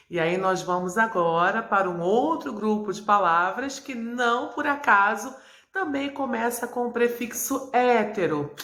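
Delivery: ordinary delivery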